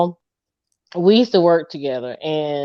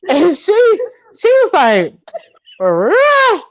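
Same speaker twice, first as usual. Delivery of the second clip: high, laughing through the words; 12 semitones higher, slower than usual. Real, not acted. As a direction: crack up mid-sentence. Laughing fit while telling.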